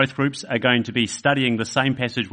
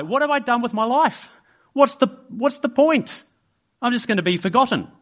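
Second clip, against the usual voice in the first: much higher, anguished inner panic; dismayed old man; 11 semitones higher than usual. Acted. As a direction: spiral into alarm at a wasted life. Old man panics about wasted life.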